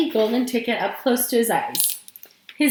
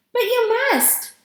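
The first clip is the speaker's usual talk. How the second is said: high, drawn-out plea; pleading insistence; insistent urging; 11 semitones higher, slower than usual. A narrator's reading. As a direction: insist on it, slow and pitched up. Wife urging her reluctant husband.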